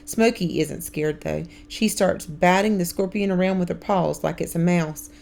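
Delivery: ordinary delivery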